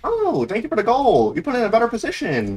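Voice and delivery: put-on squeaky gratitude; high-pitched thanks. Gamer thanking for the goal, high-pitched thanks. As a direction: lift the voice high as you thank them.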